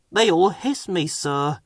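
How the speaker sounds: sighing. A narrator's reading. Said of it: "sighed Edward".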